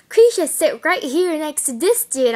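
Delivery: retold loud seating command; imitated teacher. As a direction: call out the order like a teacher. Imitates teacher giving seat order.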